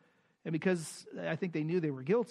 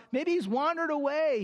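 higher, sing-song jeering; mock the prophets as Elijah would; mocking taunt; 8 semitones higher than usual. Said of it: "as he mocks them".

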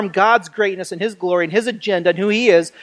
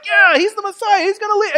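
mock crowd cheering, pitched way up; lightly voiced eager followers buying it; gullible crowd; 12 semitones higher than usual. Preacher mimicking a gullible crowd, mock eager.

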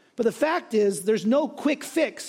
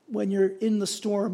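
ordinary delivery

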